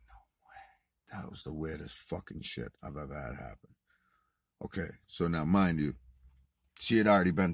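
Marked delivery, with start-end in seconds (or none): 0.1-1.2 whispered disbelief under the breath; stunned whisper. Retold or imitated. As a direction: breathe it to yourself, shaken. Alone in cell, stunned.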